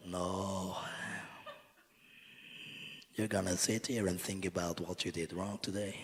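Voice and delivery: softly scolding turtle, lightly voiced; turtle-like conductor. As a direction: imitate a turtle quietly laying down the law. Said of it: "the turtle goes like".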